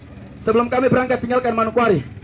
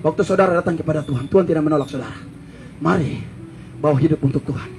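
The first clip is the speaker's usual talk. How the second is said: tender altar call; 7 semitones lower than usual. Acted. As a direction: gently draw them forward.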